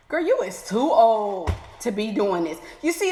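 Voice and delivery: ordinary talk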